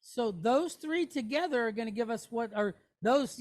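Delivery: ordinary talk, nothing marked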